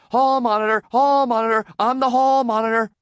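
high, loud victory chant; excited shouting. A narrator's reading. Winner racing round the classroom, shouting excitedly. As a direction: shout it over and over in triumph. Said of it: "All the while he shouted".

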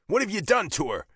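angry growl. A narrator's reading. Biker grabbing girlfriend's father by lapels, growling. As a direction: growl the accusation. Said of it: "I growl".

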